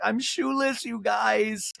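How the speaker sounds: high-pitched wail impression; mock crying. Castmate crying over her lost shoe, mock wail. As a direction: whine it high, as if tearful. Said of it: "she starts to cry".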